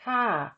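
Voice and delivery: ka with a Thai falling tone. Shown as a demonstration. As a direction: let ka drop. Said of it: "the tone is falling, as in".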